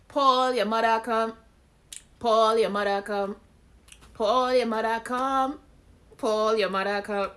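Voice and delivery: sung out loud and high in a Caribbean accent; Caribbean accent, chanted like a song hook. Shown as a demonstration. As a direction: run the words together with a Caribbean lilt. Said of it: "say it all together in your Caribbean accent".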